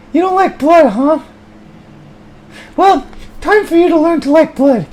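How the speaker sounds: high, ranting abusive voice; high angry character. Acted. High furious voice cursing over the rope. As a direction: rant and curse in a high voice.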